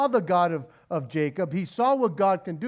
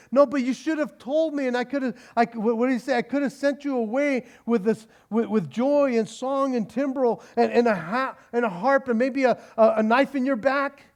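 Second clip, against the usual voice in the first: higher, louder whiny protest; mock Laban; 6 semitones higher, 4 dB louder than usual. Laban protesting to Jacob, mock hurt and higher. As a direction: lay it on as the hurt father.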